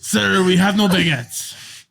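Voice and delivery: sorry-not-sorry French baker voice, slow; French shopkeeper. Pretend. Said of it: "speak with a French accent".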